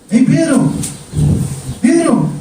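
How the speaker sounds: the Spirit calling Pedro by name. Acted. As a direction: call him like from across a room.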